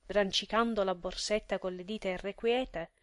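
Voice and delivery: ordinary delivery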